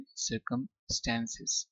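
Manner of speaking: "सर्कमस्टेंसेस" slowly, American flat a in STAN; American pronunciation. Shown as a demonstration. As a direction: model "सर्कमस्टेंसेस" in two slow parts, circum-STANCES. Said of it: "American accent में क्या बोलेंगे". (अमेरिकी उच्चारण में धीरे-धीरे "सर्कमस्टेंसेस")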